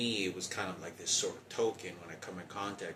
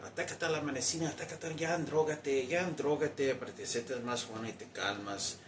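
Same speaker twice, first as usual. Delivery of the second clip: mother's taunting voice; 7 semitones higher, 4 dB louder than usual. Retold or imitated. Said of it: "my mother used to always say to my father".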